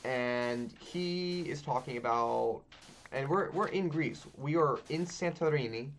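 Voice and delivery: ordinary delivery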